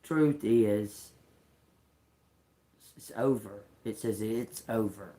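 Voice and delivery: ordinary talk, nothing marked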